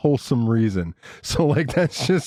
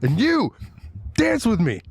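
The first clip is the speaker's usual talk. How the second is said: high, bossy mock order; demanding prom date; 8 semitones higher than usual. Imagined daughter to boy at prom, bossy.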